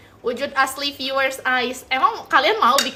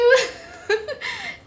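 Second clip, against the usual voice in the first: tawa geli tinggi; 13 semitones higher than usual. Real, not acted. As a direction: burst of high giggling. Malu dipuji seleb. (tawa malu melengking)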